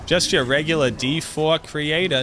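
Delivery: thick, hammed-up Boston accent, drawn out. Streamer jokingly denies being Bostonian, in a heavy Boston accent. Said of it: "no Bostonian here, sir".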